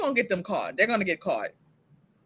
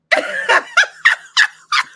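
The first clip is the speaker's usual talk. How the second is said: high burst of laughter; 7 dB louder than usual. Real, not acted. Cracks up at the scene.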